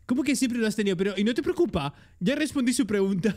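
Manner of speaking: ordinary talk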